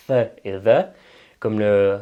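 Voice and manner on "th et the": "th et the": voiceless TH, then voiced TH; English TH sounds. Shown as a demonstration. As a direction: demonstrate "th" and "the" with tongue-between-teeth English sounds. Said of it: "les deux déclinaisons du T H". (« th et the » : les deux sons TH anglais, sourd puis sonore)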